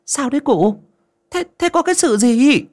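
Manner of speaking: high-pitched, stammering alarm; flustered woman asking what happened; panicked neighbour woman. A narrator's reading. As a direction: blurt it out in a high, anxious voice. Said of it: "Một bác gái hớt hải".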